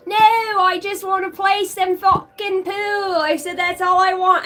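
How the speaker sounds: mock British. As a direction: plead in a hammed-up British accent. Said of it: "I had to fucking act like I was fucking British".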